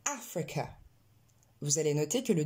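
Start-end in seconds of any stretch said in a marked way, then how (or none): 0.1-0.7 "Africa" opening on a wide English a; softer "Africa", wide first vowel, weak last one. Shown as a demonstration. Teacher starting practice on the A sound, open A pronunciation. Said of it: "s'entraîner un petit peu".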